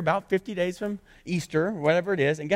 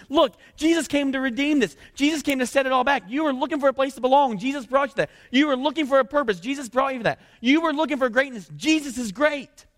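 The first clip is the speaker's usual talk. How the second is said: preaching; 6 semitones higher than usual. Retold or imitated. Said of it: "And he goes".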